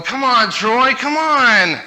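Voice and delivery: put-on high, loud kid calling his brother; impatient little brother. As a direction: nag him to hurry up like a kid brother. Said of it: "my brother's going".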